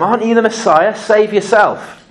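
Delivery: high-pitched jeering challenge; acted criminal's mockery; hurled insult. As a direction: throw it at him as a taunt, slowing at the end. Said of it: "he hurled insults at him".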